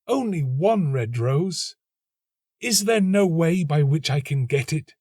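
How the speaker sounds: high, slow insistent plea; begging for only one rose; nightingale crying out. A narrator's reading. Nightingale pleading with the rose tree, high and slow.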